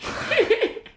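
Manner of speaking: high cackling laugh; witch cackle. Real, not acted. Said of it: "笑い方がなんかほんと魔女みたいだね".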